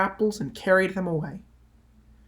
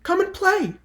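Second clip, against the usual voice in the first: much higher, pleading little voice; tree's high invitation; 13 semitones higher than usual. A narrator's reading. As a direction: coax the boy to play, lifted high.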